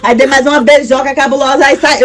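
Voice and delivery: ordinary talk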